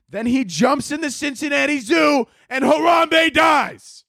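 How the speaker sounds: high, drawn-out angry yell; angry shout. Acted. Comic telling the Harambe story, angry shouting. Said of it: "I sound angry".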